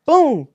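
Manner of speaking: loud, drawn-out spoken impact; imitated crash. Storyteller showing cops ramming his car, loud crash imitation. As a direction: punch out the crash noise, slow and loud.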